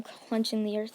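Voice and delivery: usual speaking voice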